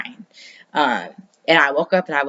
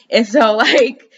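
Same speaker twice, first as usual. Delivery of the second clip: laughing; 7 semitones higher, 5 dB louder, slower than usual. Real, not acted. Laughing at mom not cussing.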